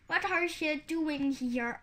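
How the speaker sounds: plain reading voice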